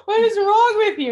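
high, slow, laughing half-cry of disbelief; laughing outrage. Retold or imitated. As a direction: laugh through mock indignation. Outraged at the doctor.

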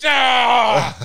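loud imitated yelp. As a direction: blurt out a sudden cry. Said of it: "Corey's like".

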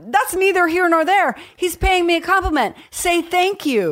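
exasperated self-scolding. Retold or imitated. Scolding her younger self over a compliment, exasperated and high. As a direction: pitch it up as if telling yourself off.

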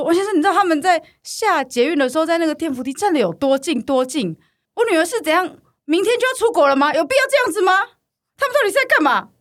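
high, loud rising hysteria; hysterical mother. Retold or imitated. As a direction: build into a shrill hysterical rant. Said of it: "很歇斯底里的状态". (越说越歇斯底里的妈妈)

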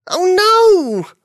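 high, loud cry of alarm. Acted. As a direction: shout it as things fly. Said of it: "Buffy cried".

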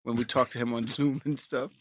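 low, giggly stoned mumble. Real, not acted. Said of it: "you're so stoned right now".

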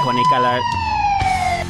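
slow, high drawn-out squeal. Pretend. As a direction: stretch it out in a shriek. Catchphrase called out.

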